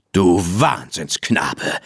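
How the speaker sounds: ordinary delivery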